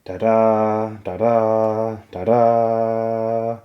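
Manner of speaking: loud sung trumpet fanfare; trumpet call. A narrator's reading. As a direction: sing it out like a brass trumpet. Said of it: "The Lannister trumpet answered".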